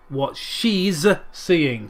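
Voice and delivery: usual speaking voice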